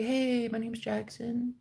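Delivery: slow, very soft imitation; mimicked timid stranger; meek introduction. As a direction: introduce yourself sheepishly, drawn out and quiet. Acted meek stranger introducing himself.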